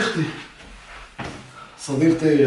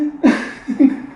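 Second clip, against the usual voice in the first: breathy laugh; 11 semitones higher than usual. Real, not acted. Prank revealed, husband laughs.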